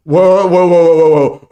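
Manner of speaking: loud, high, startled "whoa, whoa". Real, not acted. Host stopping a viewer's message, loud startled whoa. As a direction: pull the brakes with a loud surprised whoa.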